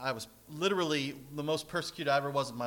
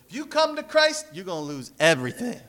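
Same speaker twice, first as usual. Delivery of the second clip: laughing mock slogan; 5 semitones higher, 7 dB louder than usual.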